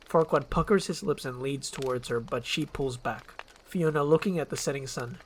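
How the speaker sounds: ordinary delivery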